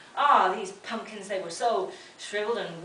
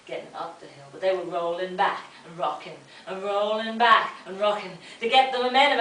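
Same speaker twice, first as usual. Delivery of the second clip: ordinary delivery, nothing marked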